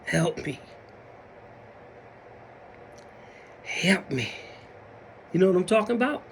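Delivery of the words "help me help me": low, soft, drawn-out mock groan; imitating someone dreading the day; weary waking plea. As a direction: plead feebly, with long pauses. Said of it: "you wake up some days and you like".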